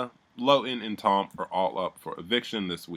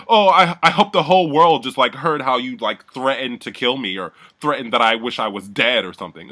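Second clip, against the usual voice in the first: loud, high, hammed-up indignation; mock outraged complaint; 4 semitones higher, 10 dB louder than usual. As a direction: imitate him playing the wronged victim. Retold dramatic complaint after an insult.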